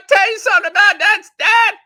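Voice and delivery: real high, loud tipsy relative; Uncle Fred. Acted. Tipsy uncle getting rolling at his nephew, very high and loud. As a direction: shout it way up high, a few drinks in. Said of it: "His voice got real high".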